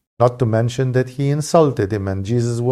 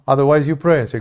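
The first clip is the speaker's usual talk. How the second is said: usual speaking voice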